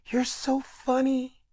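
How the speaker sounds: high, soft and slow; airy little high voice, drawn out. Retold or imitated. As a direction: softly coo it up high. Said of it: "He just looks me dead in my face and goes".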